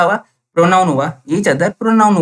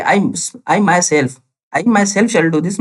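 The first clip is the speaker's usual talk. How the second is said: myself drawn out slowly and pressed hard; very slow, punching myself to show emphasis; stress on myself; much slower than usual. Shown as a demonstration. Teacher showing students the emphasis, myself stressed. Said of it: "अब क्या कर रहे हैं यहाँ पे कि".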